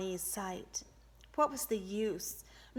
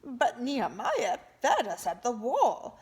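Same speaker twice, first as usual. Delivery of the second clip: objecting; 4 semitones higher, 7 dB louder than usual. A narrator's reading. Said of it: "someone objected".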